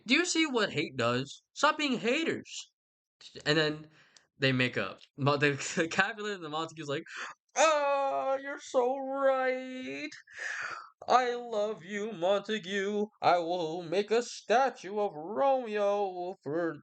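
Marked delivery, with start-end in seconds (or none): 0.1-2.7 high, slow preachy lecture to the families; a prince wagging his finger, sing-song; high, drawn-out mock reprimand; scolding prince. 7.6-16.8 high, very slow, syrupy reconciliation; a father melting into apology, all sweetness; high, dragged-out mock tenderness; gushing Capulet. Capulet making up with Montague.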